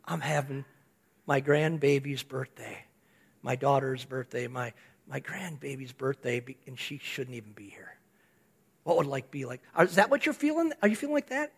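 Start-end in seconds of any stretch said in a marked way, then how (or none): 0.0-6.4 low, soft, hushed inner thoughts; soft awed murmur, nearly whispered at the end; quiet grateful inner voice. Retold or imitated. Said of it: "You're going". 6.4-8.0 hushed whisper. Retold or imitated. Awed thought of survival. 8.8-9.6 low, soft, hushed inner thoughts; soft awed murmur, nearly whispered at the end; quiet grateful inner voice. Retold or imitated. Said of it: "You're going".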